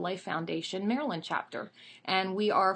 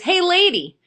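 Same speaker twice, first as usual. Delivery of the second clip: high, loud parrot call; cheeky bird calling out, high and loud; heckling parrot; 11 semitones higher, 13 dB louder than usual. Acted. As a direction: squawk it out at a passer-by. Parrot heckles a passing lady.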